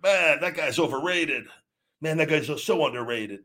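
lightly mocking armchair fan; mocking sports fan. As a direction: scoff like a couch critic. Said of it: "but like".

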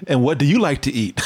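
usual speaking voice

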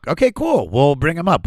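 high-pitched, easygoing acted reply; agreeable reply. Comic telling [name]'s people he can join in, agreeable.

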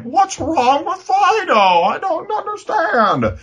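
very high, drawn-out wail; slow, high-pitched hammy bewilderment; mock bewildered owner. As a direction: whine it like a clueless pet owner. Mock bewildered dog owners' lament.

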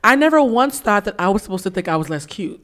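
ordinary talk, nothing marked

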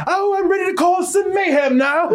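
high-pitched mock British villain; theatrical fake British, gleefully up to mischief; Mister Gray. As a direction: relish it in a hammy British accent. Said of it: "Mister Gray has the British accent".

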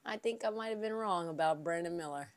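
"I think I might have been wrong about Brandon Miller" murmured low and soft; soft reluctant admission; hushed confession. Shown as a demonstration. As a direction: confess it under your breath.

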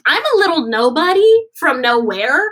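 retold starstruck disbelief, pushed up and out; overwhelmed protest. Unknown singer to the star thanking her, overwhelmed.

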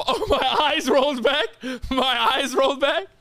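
high, incredulous echo through laughter; laughing mockery. Friend throwing back the choking story, laughing. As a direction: squeal it back in mock disbelief. Mockingly echoing friend's embarrassing claim.

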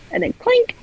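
a sudden high squeaky "clink"; imitated clink. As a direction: pop the word up high like metal tapping. Magnet hits the sunken gun.

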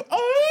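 high, loud mock wolf howl; hammy howling cry of outrage.

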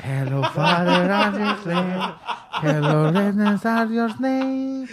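loud broken English through laughter; hammed-up greeting, laughing hard; laughing mock English. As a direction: repeat the line cracking up, slow. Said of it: "escuchó".

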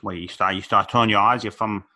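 put-on half-hearted Boston accent; loosely faked Boston townie accent; Boston accent attempt. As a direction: try a South Boston accent, not very well. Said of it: "I can't do a Boston accent".

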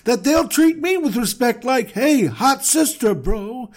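goofy high bro pitching a scheme; dude-bro Abram. Pretend. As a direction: sell the plan like a stoked bro. Abram pitches his plan to wife.

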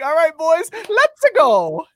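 high, loud huddle hype; pumped-up quarterback. Retold or imitated. Imitating DeVito pumping up teammates, loud and high. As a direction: fire up the team in the huddle. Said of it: "He's like".

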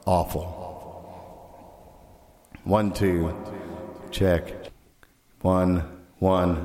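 mic with bathroom echo. Real, not acted. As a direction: voice in a tiled room. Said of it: "It sounds like you're in a bathroom".

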